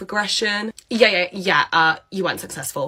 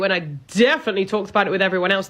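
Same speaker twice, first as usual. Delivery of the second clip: usual speaking voice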